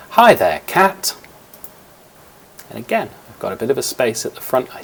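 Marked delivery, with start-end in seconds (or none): 0.1-1.1 a hinted crab character, much louder, cat sliding higher. Acted. Teacher recording the crab's reply, loud crab voice. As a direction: greet the cat as a cheeky cartoon crab. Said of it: "what the crab says".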